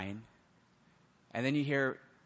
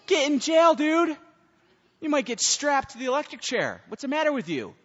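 much higher and louder, yelling at the defendant; stern judge shout; 12 semitones higher, 7 dB louder than usual. Acted. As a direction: bark the sentence like an outraged judge. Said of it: "what would he do?".

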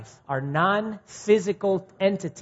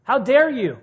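high, loud, offended singer; mock the bruised ego, higher and louder; indignant outrage; 8 semitones higher, 5 dB louder than usual. Preacher acting a rebuked singer, loud indignant outrage. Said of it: "and we're like".

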